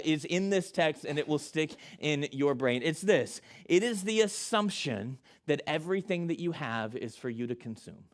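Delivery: ordinary talk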